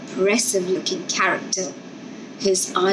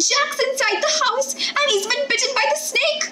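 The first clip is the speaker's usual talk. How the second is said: put-on high, wailing child voices; children running to their father in distress. A narrator's reading. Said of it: "they wailed".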